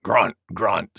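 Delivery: low grunting boar. Acted. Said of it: "said Wild Boar".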